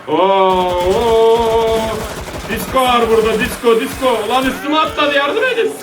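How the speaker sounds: high-pitched panicky gamer; alarmed cry. Real, not acted. Attacked in game, calls for help. (panikle tizleşen ses)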